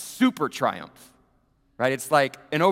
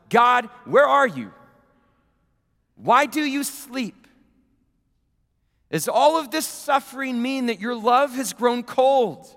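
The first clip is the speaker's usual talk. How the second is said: louder, higher pleading cry; anguished cry to God; 7 semitones higher, 6 dB louder than usual. Acted. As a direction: call out to a God who seems asleep. Voicing the psalmists' anguished cry.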